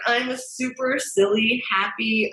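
normal talking voice

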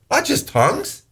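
not leapt up high; a sudden high-pitched not just tongues; stress on not. Real, not acted. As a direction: hit the word not hard and high. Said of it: "That means with all prayer".

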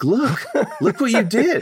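high, laughing; laughing mock appeal. Laughing at McEnroe over his yelling daughter, high-pitched. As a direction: giggle it at him in disbelief. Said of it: "laughs make eye contact with him and be like".

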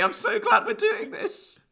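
high, slow, put-on cheery voice; mock prim contentment. Guest mocking the relaxed partner, high slow put-on cheer. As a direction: play the smug relaxed spouse.

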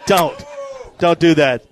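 normal talking voice

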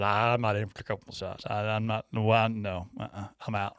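low, soft retold protest; muttered refusal. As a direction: mumble an inner refusal to yourself, acted out. Retold inner refusal of massage.

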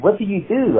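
lightly voiced French questioner; old French man. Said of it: "he was an old French guy".

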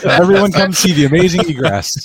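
high, showy barker call; carnival barker. Pretend. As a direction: hawk it like a sideshow barker.

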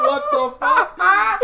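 loud, high, drawn-out disbelief; stunned outburst, stretched out for comedy; retold shock. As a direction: shout it slowly in mock alarm. Reacting to friend seeing people in trees.

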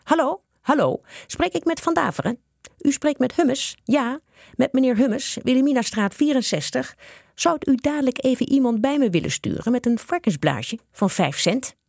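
high, courteous telephone voice; very polite. A narrator's reading. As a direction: put on careful politeness for a phone call. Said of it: "begon heel beleefd". (heel beleefd, met hoge telefoonstem)

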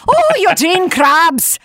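put-on high, loud old Irish mother calling; old lady scolding her grown son; Betsy Krabs voice. Shown as a demonstration. Voice actor demos Betsy calling her son Eugene. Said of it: "I usually go into the".

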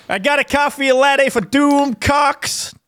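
high, slow, cartoonish barista call; exaggerated cartoon intonation. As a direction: sing out the name like a cartoon barista. Mock barista calls out name.

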